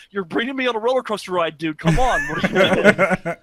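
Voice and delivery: loud, laughing disbelief; imitated exasperated audience. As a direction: protest to the presenter, half laughing. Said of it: "in the theater was like".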